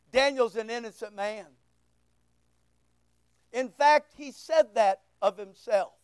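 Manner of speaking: normal talking voice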